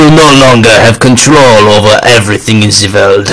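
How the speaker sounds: lightly put-on German accent; a hint of a German villain accent; mock German accent, barely there; attempted German accent. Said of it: "I can't even do a German accent".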